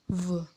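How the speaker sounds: soft buzzed V with rounded mouth; rounded V sound. Shown as a demonstration. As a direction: say V as "vuh", lips rounded. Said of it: "mulut dibulatkan". (bunyi V lembut, mulut dibulatkan)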